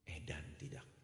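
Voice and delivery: whispered in disbelief; whispered aside. Real, not acted. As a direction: mutter the shock under breath. Priest's shocked aside. (berbisik tak percaya)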